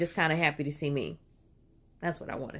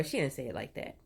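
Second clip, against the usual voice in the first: ordinary talk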